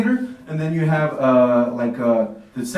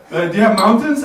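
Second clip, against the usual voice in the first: louder, higher eager question; tourist voice; 6 semitones higher, 8 dB louder than usual. Retold or imitated. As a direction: ask like an excited tourist. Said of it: "It was like".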